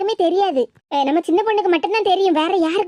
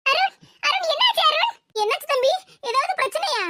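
higher, anxious calling of Arun's name; worried calling; 8 semitones higher than usual. Acted. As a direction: rush to him worried. Anitha worried about Arun.

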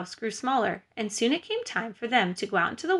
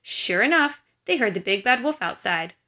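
usual speaking voice

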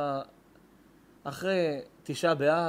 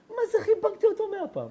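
much higher and louder squeaky mockery; mock squeak; 5 dB louder than usual. Rabbi teasing his own gushing words to the crowd, high mocking squeak. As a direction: poke fun at the gushing line.

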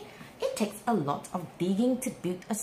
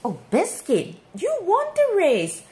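excited; 8 semitones higher, 7 dB louder than usual. Acted. Puppy wins the race.

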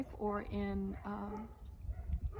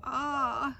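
louder cry of sudden idea; excited cry; 4 semitones higher, 7 dB louder than usual. Acted. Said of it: "he cried".

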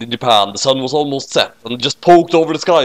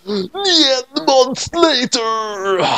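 much higher, very slow trailer voice; dramatic announcer; 12 semitones higher, much slower than usual. Acted. Dramatic time-skip card.

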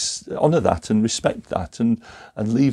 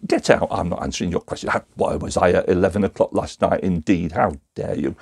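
a touch of offended suspect; understated huffy refusal; lightly acted outrage; mild indignation; 6 semitones lower, faster than usual. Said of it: "angry and emotional response".

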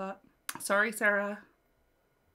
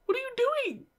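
excited squeal. Real, not acted. Fangirl squeal at music video.

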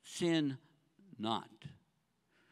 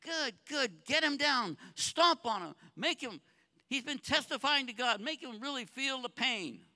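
lightly voiced gloating Satan, pitched higher; Satan cheering; 7 semitones higher than usual. Satan cheering as David suffers, mild gloating voice. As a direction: egg on the crowd with mild glee. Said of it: "Satan's cheering".

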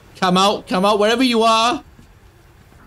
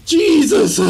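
high stammer bursting into curses; real startled, high-pitched outburst; panicked cursing; 10 semitones higher, much slower than usual. Real, not acted. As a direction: stammer in fright, then swear at it. Attacked in game, curses.

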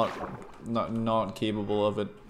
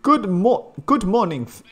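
high, loud attempt at a British accent; 10 semitones higher, 8 dB louder than usual. Streamer attempting a line for Panda on request, British accent. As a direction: put on an exaggerated British accent, pitched up. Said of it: "say this, this in British accent".

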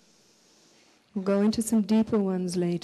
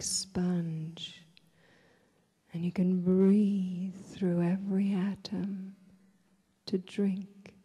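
guided meditation; 4 dB quieter than usual. Real, not acted. Leads a breathing meditation.

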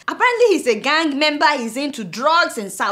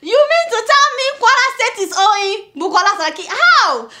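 high, loud, laughing disbelief; mock outrage at the absurd claim; incredulous disbelief; 9 semitones higher, 4 dB louder than usual. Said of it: "I was like".

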